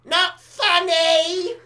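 high, drawn-out mock shout; retold heckle shout. Woman shouting across the room at the comic, mock shout. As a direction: stretch it into a long, high-pitched heckle. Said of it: "she shouts across the room".

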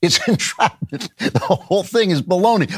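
amused scoffing through a chuckle; laughing dismissal. Real, not acted. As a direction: laugh it off as nonsense. Laughs off the case as absurd.

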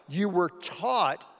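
slow, heavy taught; slow down and land on taught; deliberate weight on taught; stress on taught. Shown as a demonstration. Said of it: "I emphasize the word taught".

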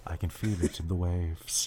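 usual speaking voice

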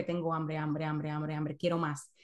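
low, soft, drawn-out craving chant; voice of the craving brain. Retold or imitated. The brain nagging you it is hungry, low quiet craving voice. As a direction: murmur it low like a hungry inner voice. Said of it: "lo transmiten que".

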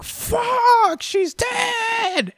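high, shocked yell of disbelief; shocked shout. Retold or imitated. The crew yelling in shock as she falls. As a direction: cry out in horror that she's dead. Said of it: "everybody just looking like".

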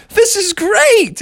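put-on high, drawn-out delighted kid; Froggy. Boy at his surprise party, thrilled. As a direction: stretch it out with glee. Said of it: "and Froggy was like".

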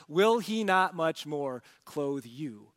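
normal talking voice

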